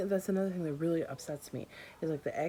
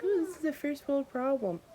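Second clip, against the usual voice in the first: mock commenter voice; 10 semitones higher than usual. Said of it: "before anybody comes in like".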